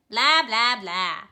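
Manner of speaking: loud mocking blah blah blah; bad eggs jeering, loud and dismissive. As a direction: brush him off with a bored taunt. Bad eggs brush him off.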